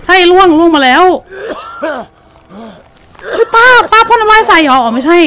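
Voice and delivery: real fright at the aunt behind her; scared yelling. Real, not acted. Startled by falling debris.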